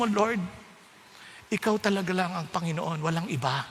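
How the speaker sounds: low, soft, humble prayer; quiet surrender prayer. Acted.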